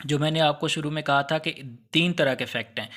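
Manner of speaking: normal talking voice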